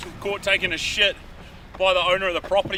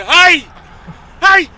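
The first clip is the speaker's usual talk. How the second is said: much louder, higher mock shout; retold angry yell; 13 semitones higher, 19 dB louder than usual. Property owner yelling at the man squatting outside his house, mock yell. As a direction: yell like a farmer catching a trespasser.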